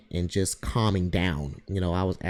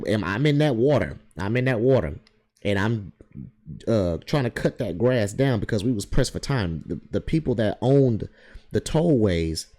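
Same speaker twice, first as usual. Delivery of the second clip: normal talking voice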